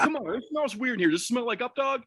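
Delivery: high, coaxing prankster; playing it straight. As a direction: imitate a friend insisting on a silly setup. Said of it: "Ross is playing it straight".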